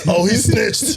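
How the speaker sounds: high-pitched mock outrage; exaggerated, high mocking quote; mocking complainers. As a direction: mimic silly tattletale critics in a high voice. Mocking people who care about snitching.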